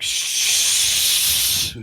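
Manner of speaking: unvoiced shush. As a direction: hush someone sharply. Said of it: "I'm like".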